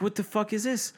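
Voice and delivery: high-pitched retold indignation; indignant disbelief. Driver retelling his reaction to an undeserved ticket, indignant disbelief. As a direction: act out baffled outrage, pitch jumping up.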